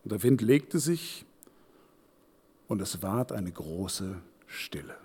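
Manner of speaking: fading to a soft, slow, low close; hushed stillness. A narrator's reading. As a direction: let the words die into silence. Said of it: "So wie jetzt".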